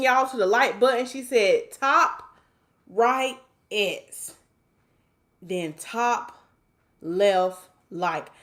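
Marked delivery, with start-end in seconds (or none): 1.7-8.3 word by word, long gaps between; slow reading. Real, not acted. Host reads like-button directions to viewers, slow and halting. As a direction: read it out very slowly, piece by piece. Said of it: "I have to read that slow".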